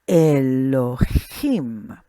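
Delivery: "Elohim" drawn out slowly, e-lo-HEEM; teacher sounding out "Elohim"; slow Hebrew word. Shown as a demonstration. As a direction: stretch out "Elohim" for students. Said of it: "accent marks that we have here".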